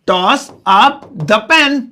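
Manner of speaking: "toss up the pen" stretched word by word, very slow; very slow repeat. Shown as a demonstration. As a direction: repeat "toss up the pen" very slowly so learners catch it. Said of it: "मैं इसे English में कहूंगा". (बहुत धीरे दोहराते हुए)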